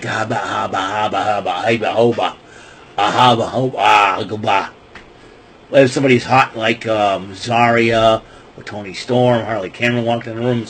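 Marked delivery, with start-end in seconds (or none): 0.0-4.7 nervous babbling haba noises, then a quick goodbye; flustered stammer. Retold or imitated. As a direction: babble like you're starstruck and scared. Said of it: "I'd be like".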